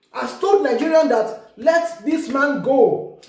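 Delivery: usual speaking voice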